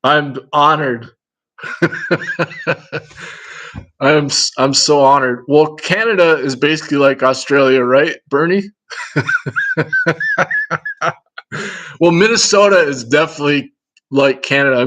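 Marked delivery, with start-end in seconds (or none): 1.6-3.0 soft, breathy chuckle; quiet, real laugh without voice. Real, not acted. Honored to have three Australians watching, soft breathy laugh. 8.9-11.1 long, high giggle; real run of high-pitched laughter. Real, not acted. Teasing Bernie that Canada is like Australia, high giggling laugh.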